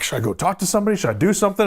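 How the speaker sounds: frantic. Retold or imitated. Retelling own freak-out.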